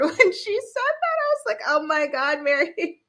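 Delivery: real laughter at Mary; laughing. Real, not acted. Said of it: "I laughed so hard".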